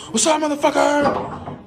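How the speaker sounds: high, slow jump-scare taunt; spring out at someone on the toilet; mock scare shout. Prankster jump-scare in bathroom.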